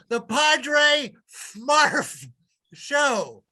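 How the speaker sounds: slow showman title call; drawn-out announcement. Acted. Host announcing his show, drawn out. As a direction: stretch the show name out like a host.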